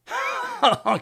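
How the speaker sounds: high, scornful cackle of rage; angry sneer laugh. A narrator's reading. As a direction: Lupin laughing with spite. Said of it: "Il ricanait rageusement". (ricanement rageur)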